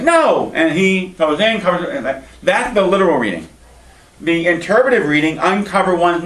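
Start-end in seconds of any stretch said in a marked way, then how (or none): 0.0-0.5 high, firm mock refusal; refusing. Shem refusing his brothers, high firm no. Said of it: "He's like".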